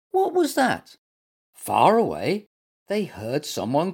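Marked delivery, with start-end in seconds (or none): 0.1-1.0 very high, startled squeak; squeaky little animal. A narrator's reading. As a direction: squeak the question in alarm. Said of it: "squeaked Little Mutt".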